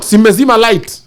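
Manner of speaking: loud, high, laughing call; laughing shout. Real, not acted. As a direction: yell it through a laugh. Repeated line, first take shouted.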